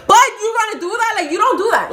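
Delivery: high, loud re-enacted yell; heated, high-pitched reproach; outraged scolding. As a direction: yell at a friend's betrayal. Acted quarrel with best friend.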